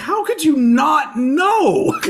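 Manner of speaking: high, drawn-out mock outcry; incredulous. Music fan answers the clueless outsiders, hammed-up incredulity. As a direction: exclaim in exaggerated disbelief, laugh breaking in.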